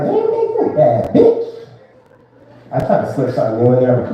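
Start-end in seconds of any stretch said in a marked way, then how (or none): 0.0-1.5 high, slow mock hype-girl squeal. Boyfriend hyping up his girlfriend, high squealing voice. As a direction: squeal it like you're gassing her up.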